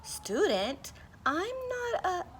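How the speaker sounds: a bewildered puppy's protest; confused dog. Acted. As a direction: pup confused at being called a student. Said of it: "Rocket was confused".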